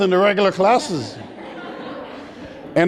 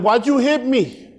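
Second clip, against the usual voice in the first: put-on whiny kid; 4 semitones higher than usual. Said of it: "I looked at him and said".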